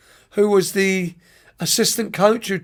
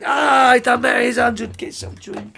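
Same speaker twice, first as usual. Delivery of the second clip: put-on drunk insisting; 4 semitones higher than usual. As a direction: shout down the refusal like a tipsy coach.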